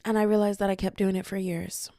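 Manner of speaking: usual speaking voice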